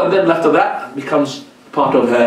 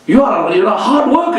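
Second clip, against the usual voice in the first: ordinary talk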